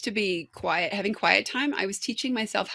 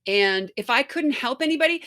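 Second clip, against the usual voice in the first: ordinary talk, nothing marked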